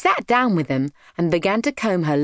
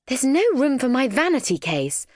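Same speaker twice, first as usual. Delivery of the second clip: higher, put-out complaint; sighing; 7 semitones higher than usual. A narrator's reading. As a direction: sigh over the lack of room. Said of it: "sighed Pandora".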